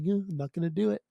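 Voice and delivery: lightly voiced presidential impression; George Bush Senior. Podcaster shows off his presidential impersonation, George Bush Senior via Dana Carvey. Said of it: "it's a mix of George Bush Senior".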